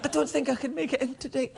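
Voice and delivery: high, feeble put-on sick voice; phone in sick, laying it on thick; hammed-up poorly voice; faking sick. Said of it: "sound a little bit more sick".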